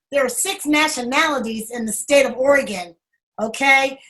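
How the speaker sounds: ordinary talk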